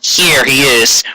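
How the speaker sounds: ordinary delivery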